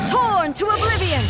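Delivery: high, loud commanding strike call; battle cry. Acted. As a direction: shout it as the blade falls. Battle cry during attack.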